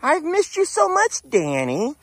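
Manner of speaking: very high, loud, slow squeal; voicing Rita gushing. Owner voicing Rita greeting Danny, very high loud gushing squeal. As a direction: squeal with longing at a lost friend. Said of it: "She's like".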